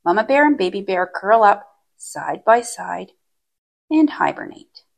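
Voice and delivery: ordinary delivery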